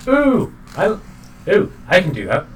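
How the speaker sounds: high, eager creature voice; excited little copycat. Acted. Game master voices Pam eager to copy dragon, high and excited. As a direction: pipe up, thrilled to try it too. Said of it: "Pam goes".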